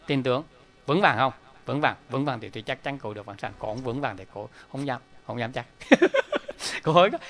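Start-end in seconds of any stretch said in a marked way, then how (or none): 5.7-6.8 breathy laugh. Real, not acted. Monk laughs after the lesson.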